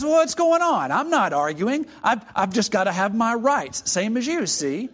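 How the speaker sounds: high, loud, defensive ghost; a ghost demanding his rights; protesting ghost. Acted.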